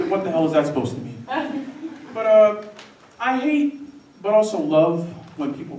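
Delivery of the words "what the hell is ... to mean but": normal talking voice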